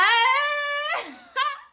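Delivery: a high laughing cry that breaks. Real, not acted. Seeing her wrapped new car, she bursts into a tearful laugh. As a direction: laugh and sob at once, up high.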